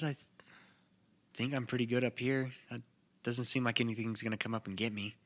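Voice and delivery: ordinary talk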